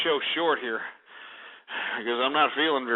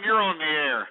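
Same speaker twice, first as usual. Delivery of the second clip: ordinary talk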